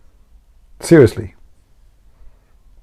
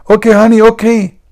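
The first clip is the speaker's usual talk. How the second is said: high, loud wifely reply; mock-eager high-pitched compliance; obedient wife; 11 semitones higher, 5 dB louder than usual. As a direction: call back in a put-on woman's voice. Wife obediently answering husband in joke.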